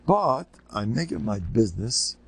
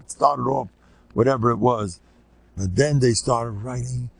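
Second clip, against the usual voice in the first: ordinary talk, nothing marked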